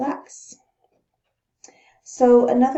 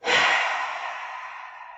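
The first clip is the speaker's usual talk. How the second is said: demo sigh. Shown as a demonstration. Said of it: "You might recognize this as that kind of inhale, exhale".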